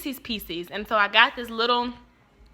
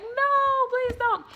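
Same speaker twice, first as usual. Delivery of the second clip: much higher, hammed-up begging; mock little-girl whine; whiny kid plea; 14 semitones higher, much slower than usual. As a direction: wail it like a desperate kid. Child begging dad.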